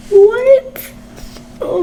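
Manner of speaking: gasp, then a high rising what; shocked gasp. Real, not acted. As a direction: gasp at the page in disbelief. Shocked by a plot twist.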